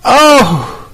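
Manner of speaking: thrilled host scrambling, giggling; excited laughing host. Real, not acted. Host thrilled by returning caller.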